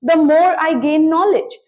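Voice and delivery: very slow, each word set apart for learners; slow model sentence. Shown as a demonstration. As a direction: spell it out slowly like a teacher giving the example. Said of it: "तो मैं कैसे कहूंगी इसको". (धीमे-धीमे, साफ़ बोलकर उदाहरण वाक्य)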